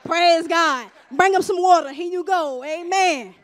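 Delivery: loud, punchy cries of praise; acted cheering along. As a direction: cheer them on from behind, loudly. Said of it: "walk behind them and say".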